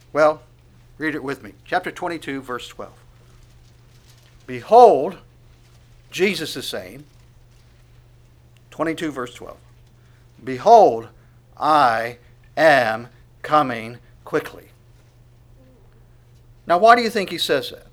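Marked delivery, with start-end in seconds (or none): 4.4-5.2 proclaimed. Acted. Preacher proclaims Jesus' words. 10.4-14.7 proclaimed. Acted. Preacher proclaims Jesus' words.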